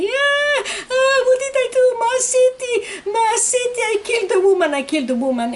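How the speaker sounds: high anguished scream. Retold or imitated. Seaman confessing to the mother, screaming high. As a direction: howl it out in guilt. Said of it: "And he started screaming".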